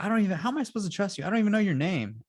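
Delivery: high, fast retold kid's complaint; mock distrustful protest. Young Leia doubts her rescuer, mock indignant protest. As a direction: imitate a child protesting, pitched well up.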